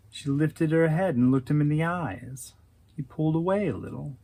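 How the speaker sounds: plain reading voice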